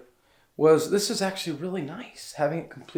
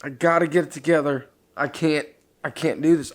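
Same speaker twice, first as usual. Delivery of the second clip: ordinary talk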